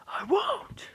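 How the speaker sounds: high, loud refusal; refusing his father's death with a shout; defiant shout. Acted. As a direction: scream it out in defiance. Boy refuses to accept death.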